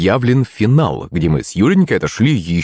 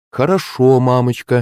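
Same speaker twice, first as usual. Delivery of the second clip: higher, sweetly obedient tone; put-on meekness; strained smile; 3 semitones higher than usual. A narrator's reading. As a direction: agree through a forced smile. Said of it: "натянуто улыбнулась Юленька".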